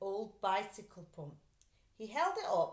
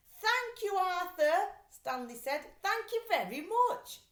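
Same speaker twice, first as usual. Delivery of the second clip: high, loud overjoyed thanks; grateful thanks; 10 semitones higher, 7 dB louder than usual. Acted. Stanley thanking his brother, high and overjoyed. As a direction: burst with gratitude.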